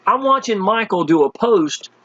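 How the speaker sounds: ordinary talk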